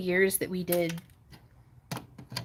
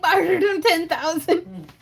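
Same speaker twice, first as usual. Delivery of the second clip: slow, higher, laughing through it; name an absurd price, laughing; laughing deadpan price; 11 semitones higher, 9 dB louder, slower than usual. Retold or imitated. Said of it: "And I was like".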